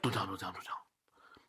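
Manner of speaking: urgent, hushed, unvoiced pleading; a whispered "don't tell him"; whispered plea. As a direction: beg him to keep the secret. Quoting movie character secret plea.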